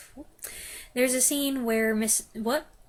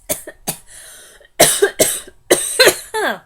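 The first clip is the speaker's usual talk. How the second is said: 1 harsh coughing fit; 9 semitones higher, 13 dB louder than usual. Real, not acted. Coughing fit mid-sentence.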